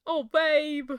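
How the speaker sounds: a put-on, hammy British accent on "babe"; deliberately bad British accent, fondly; horrible British accent. Game character consoling Fran in a horrible British accent. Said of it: "My horrible British accent".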